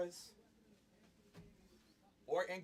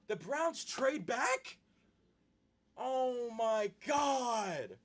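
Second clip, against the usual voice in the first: real outraged disbelief, pitched high; stunned fan wailing at bad news; shocked dismay; 7 semitones higher, 4 dB louder, slower than usual. Real, not acted. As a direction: cry out in drawn-out disbelief. Shock at team trading back.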